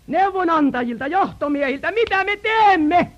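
high, loud, urgent preaching of the people's panic; impassioned high sermon voice; preaching at full force. Acted. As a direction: cry out the people's despair from the pulpit.

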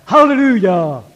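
loud, high shouted hallelujah; sudden shout. Retold or imitated. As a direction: burst out with it. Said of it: "niin huusi yhtäkkiä".

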